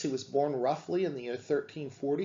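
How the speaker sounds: ordinary talk, nothing marked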